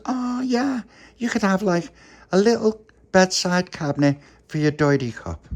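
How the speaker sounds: high little voice. Acted. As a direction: lift the voice up like a small child. Little Pappy answers baby.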